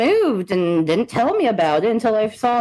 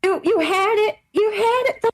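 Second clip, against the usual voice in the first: high, teary mock lament; 10 semitones higher than usual. Telling the ex he fumbled, mock-teary and high. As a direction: wail it like a fake-sad friend.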